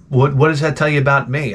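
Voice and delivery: ordinary delivery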